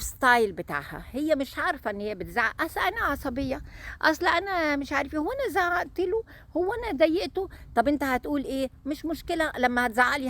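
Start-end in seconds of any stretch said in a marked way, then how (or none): 2.6-7.5 mock innocent bride. Mocking the shouting bride.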